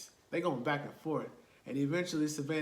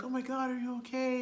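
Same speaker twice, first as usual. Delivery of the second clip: concerned deputy; 8 semitones higher than usual. Retold or imitated. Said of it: "the deputy, he's like".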